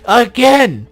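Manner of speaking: high, loud outraged again; exasperated shout. Real, not acted. Gamer stuck redoing the level, outraged shout. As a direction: throw the word out in disbelief. Repeating the same area.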